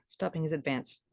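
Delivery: usual speaking voice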